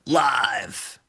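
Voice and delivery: punchy radio announcer bark; shock jock radio host. Shown as a demonstration. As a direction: belt it like a morning-zoo DJ. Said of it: "that's my shock jock voice I'm working on".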